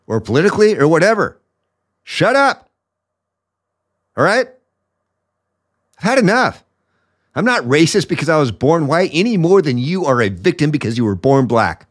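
rising into a high, strained mock rant; a hint of a shrill AOC send-up, not a full voice; mock AOC impression. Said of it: "A O C int-, impression".

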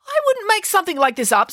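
high, indignant protest. A narrator's reading. Mum defending her story to doubting Tammy, indignant.

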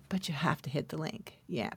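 quick conspiratorial whisper. Acted. Host letting podcast listeners in on a secret, whispered fast.

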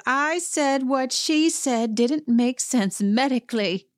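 high, slow, emphatic imitated yell; hammed-up defensive yell at moderate volume; mock yelling. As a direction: protest over a shouting group, stretching each word. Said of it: "everyone is just full on yelling".

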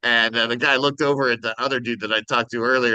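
ordinary delivery

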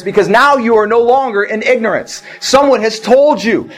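high, driving sermon voice; fervent preaching. Acted. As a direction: preach it to the congregation with fire. Preaching at full force.

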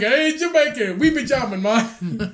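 high, hammed-up Jamaican lilt; joking Jamaican imitation; mock Jamaican accent. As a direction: play up a fake Jamaican accent. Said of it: "I sound like Jamaican".

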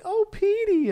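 very high cooing voice; mock swooning concern. Two women fussing over collapsed Peter, mock swooning. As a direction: imitate fawning women in falsetto.

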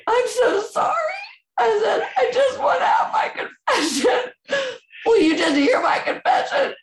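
high, tearful and breaking; crying plea. Real, not acted. Mother begging the priest for confession, sobbing high. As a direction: beg through tears.

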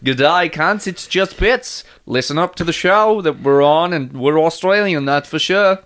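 upbeat mock-Aussie promo; hammed-up Aussie greeting; Australian accent. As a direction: sell it in a put-on Australian accent. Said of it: "doing Australian accents".

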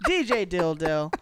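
building announcer intro of the champ; contestant introduction. Acted. Host introducing two contestants, announcer style. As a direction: hype up each contestant. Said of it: "let me introduce my two contestants".

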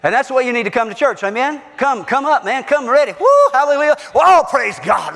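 animated preacher rising to a whoop; revival-style exhortation, building to a shout; rousing preaching. Real, not acted. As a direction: fire up the congregation. Rousing the congregation to come ready.

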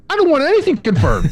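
high, put-on exasperated no; exasperated refusal. Customer brushing off the agent's confirmation question, high mock exasperation. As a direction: play the fed-up customer.

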